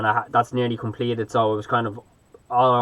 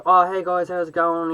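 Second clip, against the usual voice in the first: higher-pitched, mock-friendly greeting; chatty American guy; 7 semitones higher than usual. Shop guy greeting Irish tourists at the till, imitated chatty. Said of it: "being real chatty".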